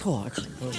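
low, soft moaning groan; dejected groan. Retold or imitated. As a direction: groan like a man just robbed. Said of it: "Dominic's in the hotel going like this".

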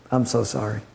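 low, heavy sorrowful voice; a grieving messenger softly delivering terrible news; somber messenger. Acted. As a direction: break the bad news gently and heavily. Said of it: "comes in somber, looking very, very down".

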